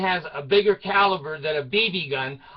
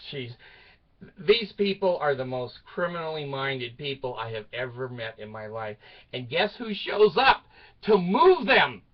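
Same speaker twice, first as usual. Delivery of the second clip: usual speaking voice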